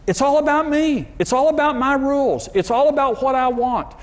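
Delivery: voicing selfishness as a whiny brat; selfish spirit. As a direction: whine like a spoiled self.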